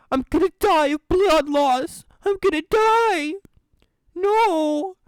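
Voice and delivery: high, crying pleas for help; desperate cries for help. Acted. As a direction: sob and beg for rescue. Trapped, bleeding, calling for help.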